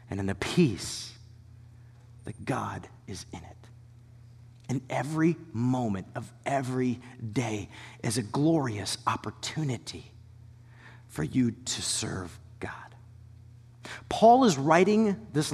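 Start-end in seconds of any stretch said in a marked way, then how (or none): 2.3-3.6 hushed whisper of faith; whispered, intimate. Real, not acted. Preacher drops to whisper. 11.1-12.7 slow hushed whisper; slow whispered close. Real, not acted. Preacher drops to whisper.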